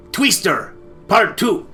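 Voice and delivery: normal talking voice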